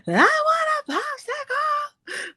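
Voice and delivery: put-on high, slow, crying toddler whine; whining toddler. Little daughter begging mom for a popsicle, high crying whine. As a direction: drag it out like a little girl about to cry. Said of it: "And she'll be like".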